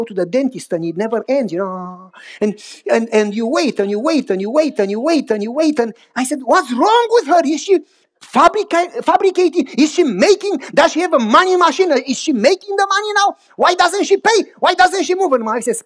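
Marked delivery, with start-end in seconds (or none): 6.4-15.3 fuming, pitch climbing with every question; exasperated rant. Retold or imitated. Impatient pastor ranting in toll line.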